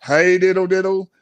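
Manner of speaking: ordinary delivery, nothing marked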